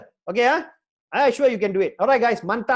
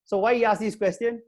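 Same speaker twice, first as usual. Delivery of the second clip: usual speaking voice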